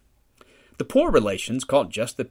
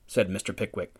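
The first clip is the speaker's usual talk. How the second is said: usual speaking voice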